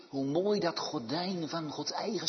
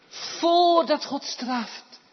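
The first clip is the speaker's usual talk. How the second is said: much higher and louder, drawn out; slowed, emphatic pulpit cry; slow, high, loud preaching stress; 12 semitones higher, 10 dB louder, much slower than usual. Acted. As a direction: lean hard on every word.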